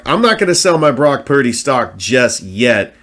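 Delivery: usual speaking voice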